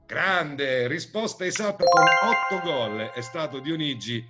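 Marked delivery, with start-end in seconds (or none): 0.1-3.1 showy game show host. Acted. Quiz host reading questions.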